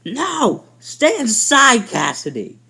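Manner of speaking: high, loud desperate shout, slow; mother yells. Retold or imitated. Mother yelling at her daughter to stay inside, desperate. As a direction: yell it to save your child. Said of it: "The mother yells".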